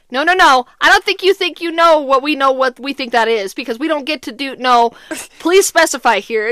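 loud, insistent flustered objection; frantic protest. Retold or imitated. Both parents demand the tech specify, retold frantic protest.

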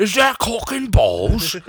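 mock posh Brit asking a question; mock British. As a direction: put on a silly British voice. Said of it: "Are you British?".

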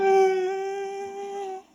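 very high, very slow weeping plea; crying out to God in the rain, high and drawn-out; tearful pleading. Retold or imitated. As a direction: beg through tears. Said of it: "Y yo ahí chillando".

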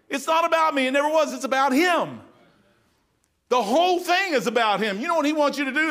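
high, loud preaching to the congregation; full-force preaching. Acted. Preacher telling the congregation who the Bible is about, in full-force preaching. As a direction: preach it out to the whole room.

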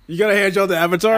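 high, loud disbelief; shocked, can't believe it; incredulous. Real, not acted.

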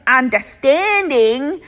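playful broad Australian accent; exaggerated Australian accent. Shown as a demonstration. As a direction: stretch "understanding" into a broad Australian drawl. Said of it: "that sounded so Australian".

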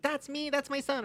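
high-pitched, softly voiced proud mom; lightly mocking high mom voice. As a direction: imitate a mom showing off her son. Imitating proud mom in public.

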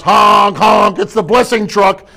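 cheerful blessing-truck announcement; mock honking call. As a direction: call out like a delivery man. Acted delivery truck arriving.

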